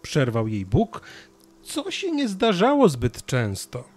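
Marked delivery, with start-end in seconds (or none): none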